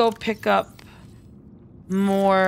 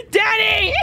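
high, loud playful yell of his name; mock outrage shout; 12 semitones higher, 3 dB louder than usual. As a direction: call Danny out in fake betrayal. Teasing viewer again.

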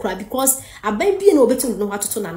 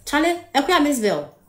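ordinary talk, nothing marked

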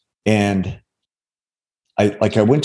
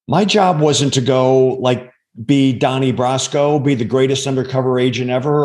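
ordinary talk, nothing marked

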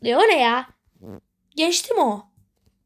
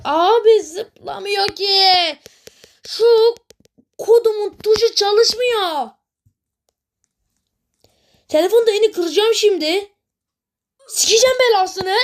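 whiny complaint; 5 semitones higher, 4 dB louder than usual. Real, not acted. Gamer raging to viewers about the broken jump key, loud whine. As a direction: whine and shout at the dead button. Controls broken, whining rage.

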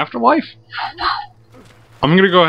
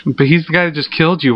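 usual speaking voice

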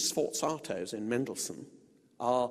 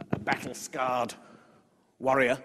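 ordinary talk, nothing marked

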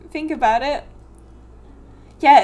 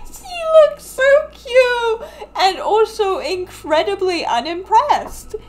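laughing gush; 8 semitones higher, 4 dB louder, slower than usual. Real, not acted. Gushing over the cat.

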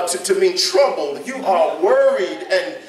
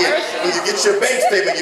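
usual speaking voice

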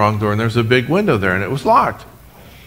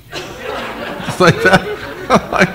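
laughing; 7 semitones higher, much slower than usual. Real, not acted. Laughing while telling.

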